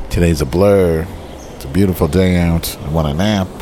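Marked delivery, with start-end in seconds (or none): none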